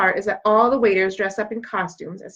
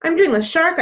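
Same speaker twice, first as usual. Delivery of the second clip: goofy high sing-song, a bit louder; silly announcing; 9 semitones higher, 4 dB louder than usual. Acted. Girl calling out her silly dance move to her friend, goofy. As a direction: show off a made-up dance move.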